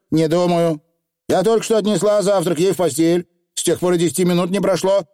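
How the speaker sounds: a hint of a brisk housewife; lightly voiced no-nonsense woman; brisk village woman.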